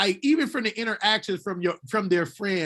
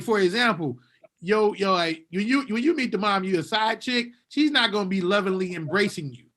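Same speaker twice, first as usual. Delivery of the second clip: ordinary talk, nothing marked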